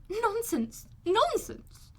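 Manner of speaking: high, scornful double dismissal; scornful Mrs Bennet. A narrator's reading. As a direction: wave it away, pitched high.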